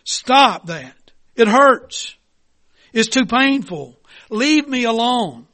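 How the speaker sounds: pained, loud plea to be left alone; high and loud, hurting and begging; crying out in pain. Acted. Teacup begs the potter to stop, pained cry. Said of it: "I cried out".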